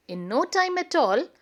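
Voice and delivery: ordinary delivery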